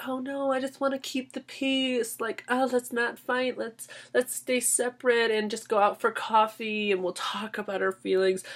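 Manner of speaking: hammed-up timid pacifist in a high voice. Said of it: "She would be that".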